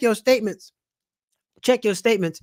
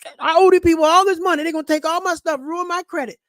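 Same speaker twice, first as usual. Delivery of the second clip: panicked debtor; 9 semitones higher, 6 dB louder than usual. Retold or imitated.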